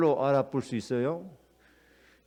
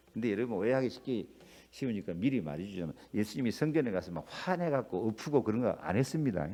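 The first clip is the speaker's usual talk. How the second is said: softer, lower, quick aside; confiding aside; 6 semitones lower, 6 dB quieter than usual. Real, not acted. Pastor quietly correcting the congregation's misunderstanding. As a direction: let them in on the truth.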